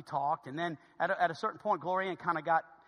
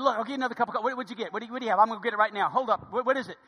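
higher, frantic retold offer to fix it; flustered; 4 semitones higher, 4 dB louder, much faster than usual. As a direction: re-enact tripping over questions in panic. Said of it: "well, I'm freaking out".

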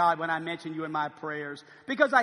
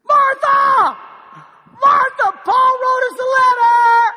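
calling out high and loud, overjoyed; excited shout; 14 dB louder, slower than usual. Acted. Philemon shouting to his wife Martha about the letter, thrilled. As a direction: yell across the house with the big news. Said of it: "let's act this thing out".

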